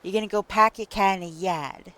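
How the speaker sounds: dropped r's, "pack your car in a yard" with broad ah and no r; Boston accent. Shown as a demonstration.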